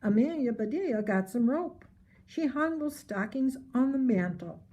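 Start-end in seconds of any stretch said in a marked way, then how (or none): none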